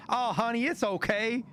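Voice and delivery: high-pitched, sweet reassurance; sweet Southern lady. Retold or imitated. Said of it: "And the lady was sweet".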